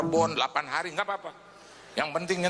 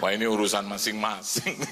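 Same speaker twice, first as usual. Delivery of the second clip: much lower, laughing shrug; mock aside; 9 semitones lower than usual. Preacher voicing the woman behind him, amused. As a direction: toss it off dryly. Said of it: "Dia cuma".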